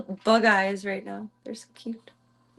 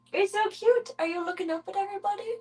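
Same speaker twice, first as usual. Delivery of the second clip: much higher cooing squeal; high pet squeal; 10 semitones higher than usual. Real, not acted. Owner squealing over how cute her kitten is.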